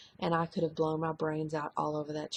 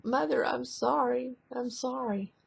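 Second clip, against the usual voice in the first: higher, tearful pleading daughter; imitating crying daughter; 7 semitones higher than usual. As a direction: echo her tearful apology, pitched up. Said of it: "and she was crying".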